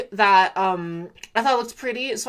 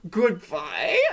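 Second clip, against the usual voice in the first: mock crying goodbye; 3 semitones lower than usual. Villager leaves, cries out.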